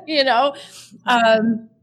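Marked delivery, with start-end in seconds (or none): 0.0-0.5 awkward laugh. Real, not acted. Self-conscious laugh after dark line.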